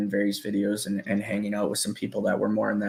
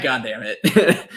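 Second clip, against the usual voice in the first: laughing; 4 dB louder, slower than usual. Real, not acted. Laughing at a friend's joke.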